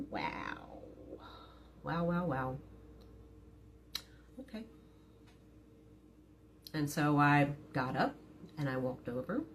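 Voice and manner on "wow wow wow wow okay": muted, disbelieving wows; sarcastic wow. Retold or imitated. Wife reacting to husband's sarcastic apology, disbelieving. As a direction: let each wow drip with disbelief.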